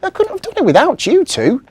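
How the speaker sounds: very high, loud smiling reply; smiling elf. Acted. Marzi happily invites the children, high smiling. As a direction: beam as you say it.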